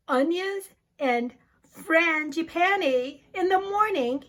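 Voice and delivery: plain reading voice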